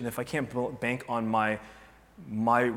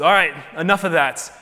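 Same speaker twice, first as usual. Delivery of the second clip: loud, higher firm cutoff; stern God; 5 semitones higher, 9 dB louder than usual. Retold or imitated. God ends the people's grumbling, loud and firm. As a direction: lay down the law, fed up.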